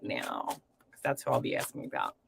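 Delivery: whispered as she turns to the cards. Real, not acted. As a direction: drop into a whisper. Trails off into quiet focus.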